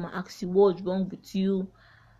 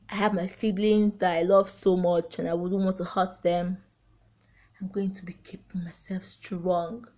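ordinary delivery

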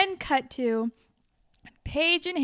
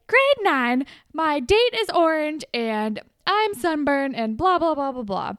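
higher and louder whiny rant; hammed-up sulky listing, higher and louder; mock complaining tirade; 3 semitones higher, 5 dB louder than usual. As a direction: list the woes like a bitter diva. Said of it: "She's like".

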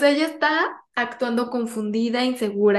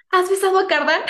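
high, loud prying question; nosy gleeful question; 8 semitones higher, 4 dB louder than usual. Retold or imitated. Mocking the rival's nosy question.

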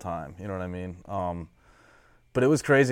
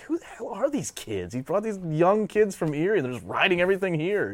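higher voiced, astonished onlookers; amazed locals; 8 semitones higher than usual. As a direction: play the baffled locals. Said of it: "everybody's like".

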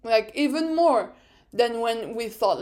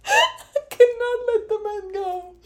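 high squeal through real laughter; helpless laughter; 12 semitones higher, 6 dB louder than usual. Real, not acted. Fan confessing obsession with Esteban, helplessly laughing. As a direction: barely get it out, laughing. Still cracking up.

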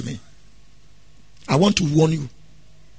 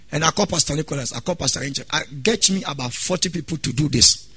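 ordinary talk

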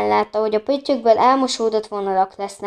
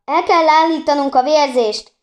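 loud, higher urgent shout; 6 semitones higher, 6 dB louder than usual. A narrator's reading. Dragomir shouting to the others over Anna, urgent and loud. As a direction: cry out to save her. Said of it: "kiabálta".

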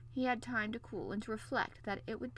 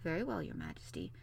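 low, subdued courtesy; mildly deferential; a little more respectful; 12 semitones lower than usual. A narrator's reading. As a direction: give in politely, voice lowered. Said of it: "becoming a little more respectful".

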